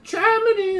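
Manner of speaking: very high, loud one-word answer; high-pitched quote of someone answering; high retold exclamation. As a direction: blurt it out in a high, loud imitated voice.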